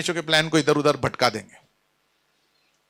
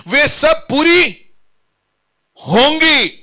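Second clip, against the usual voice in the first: high, insistent pulpit delivery; full-force preaching; 6 semitones higher, 5 dB louder than usual. Acted. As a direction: preach it out to the congregation.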